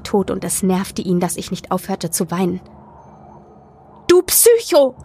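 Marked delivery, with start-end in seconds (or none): 4.1-5.0 high, loud yelled insult; furious yell. A narrator's reading. Crying narrator at pacing friend, furious yell. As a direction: yell it at him in tears. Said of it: "brüllte ich ihn an".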